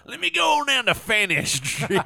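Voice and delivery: loud, high put-on English voice; mock English accent. Joking that Fanny Street sounds English, mock English accent. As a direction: ham it up as an English local. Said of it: "a place you wanna be in England".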